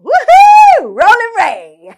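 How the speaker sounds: high, loud cheer. Acted. Opening the podcast with a loud whoop.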